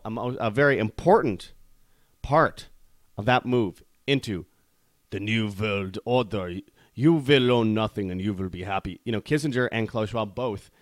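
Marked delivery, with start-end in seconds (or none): 5.1-9.0 mock a James Bond villain's German accent; fake German accent. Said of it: "very, very fake German accents".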